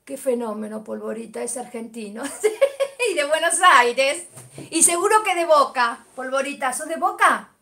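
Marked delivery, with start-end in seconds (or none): 2.3-3.0 high delighted laugh; a bright laughing burst; burst of laughter. Real, not acted. Laughing at a viewer's name, a high laugh. 3.1-7.5 higher, louder playful teasing; excited teasing. Real, not acted. Teasing a viewer about his football club, excited and loud.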